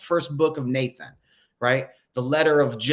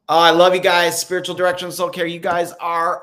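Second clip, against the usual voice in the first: much louder and higher burst of affection; gushing; 5 semitones higher, 8 dB louder than usual. Real, not acted. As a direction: throw your love out to the viewers.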